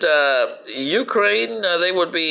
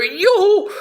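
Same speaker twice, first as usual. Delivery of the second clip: whoop; 13 semitones higher, 3 dB louder than usual. Acted. Cheerful whoop.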